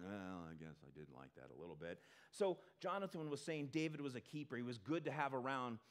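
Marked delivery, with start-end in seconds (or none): 0.0-2.0 low, soft, grudging admission; begrudging. Retold or imitated. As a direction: concede it as if it costs you. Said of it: "He's kinda begrudging in his response".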